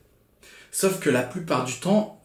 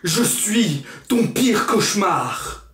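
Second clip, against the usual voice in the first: slow, higher menacing inner voice; voz de pesadilla; 6 semitones higher, much slower than usual. Acted. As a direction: announce yourself as someone's nightmare, drawn out. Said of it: "Il y a quelqu'un d'autre dans ma tête".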